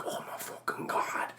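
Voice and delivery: hushed amazed whisper; awed whisper. Retold or imitated. The murmur going around the audience, hushed whisper. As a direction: whisper it like the theater around you. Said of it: "There was just, like, the general".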